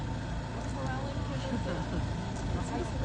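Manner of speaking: high, drawn-out laugh of disbelief; incredulous laugh. Real, not acted. Interviewee answering a claim it will be done humanely, incredulous laugh.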